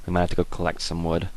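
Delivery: ordinary talk, nothing marked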